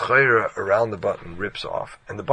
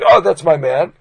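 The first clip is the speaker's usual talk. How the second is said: loud, higher, delighted; eager loud pick; pleased exclamation; 8 semitones higher, 14 dB louder than usual. Retold or imitated. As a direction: point him out gladly. Picking the tailor, pleased.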